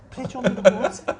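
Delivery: high, loud disbelieving echo; incredulous laughing repeat. Retold or imitated. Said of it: "I've cracked up".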